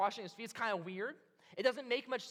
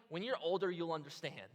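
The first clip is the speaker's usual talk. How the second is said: ordinary talk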